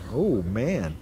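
plain reading voice

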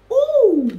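very high, loud drawn-out "ooh"; impressed squeal of discovery; surprised "ooh". Real, not acted. Reader reacting to the High Priestess card, surprised ooh.

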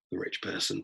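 voiceless whisper of the wrong verdict; whispered aside. Shown as a demonstration. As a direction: breathe it out, unvoiced.

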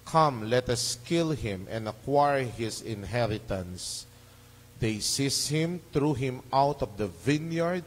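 ordinary delivery

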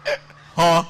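mock stuck grunts; stammering teacher. Teacher stuck while explaining aquatic animals, mock stammering. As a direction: get stuck mid-sentence, imitating a stammer. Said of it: "he's a conch stammerer".

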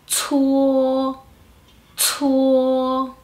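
"chuo" as puffed ts gliding into long o; aspirated CUO. Shown as a demonstration. Teacher modelling C U O, "chuo" said as breath-puffed tsuo. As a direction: exhale into "chuo", then hold it level. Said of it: "Exhalo el aire".

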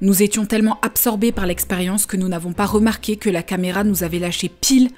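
dramatic voiceover. Acted. Voiceover narrating aftermath.

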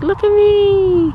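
high, loud, slow, hammed-up horse voice; voicing the horse. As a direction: give the horse a big show-off voice. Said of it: "He's like".

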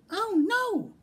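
very high, alarmed piglet squeal; piglet's scream. A narrator's reading. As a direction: scream it high like a frightened little pig. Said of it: "screamed the piglet".

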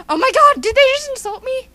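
high, loud indignant gasp of words; affronted disbelief. Acted. Just called a dumbass, affronted disbelief. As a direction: blurt it in mock offence.

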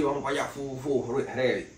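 normal talking voice

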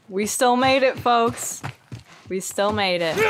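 ordinary delivery

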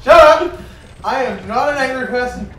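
yelling at a friend; angry shout. Real, not acted. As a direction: bark it out, loud. [name] angrily denying being angry.

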